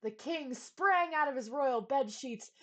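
usual speaking voice

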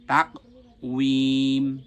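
তাকউইম, slow, drawn out on one steady note; Quranic recitation. Shown as a demonstration. Teacher recalls a word from Surah Tin, reciting it drawn out. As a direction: recite তাকউইম with the final syllable held long. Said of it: "ওই যে সূরা তিনে আছে কি".

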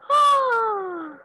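high surprised ah; acted surprise; surprised gasp. Shown as a demonstration.

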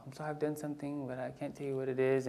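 quiet hurried apology; soft confession. Retold or imitated. Daughter softly confessing to her brother. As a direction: murmur it a bit quickly. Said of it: "she told him that".

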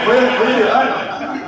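a loud, laughing mock outrage; loud laughing disbelief. Comedian loudly mocking a friend for choosing Öland, laughing in disbelief.

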